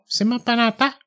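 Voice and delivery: a hint of a surprised older woman; cleaning woman voice. Church cleaner answering the boy, lightly voiced.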